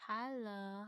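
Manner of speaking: usual speaking voice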